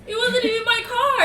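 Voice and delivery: high, loud laughing protest; cracking up, voice jumping high. Real, not acted. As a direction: burst out laughing as you admit it. Laughing at own fib.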